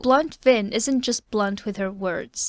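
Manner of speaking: ordinary delivery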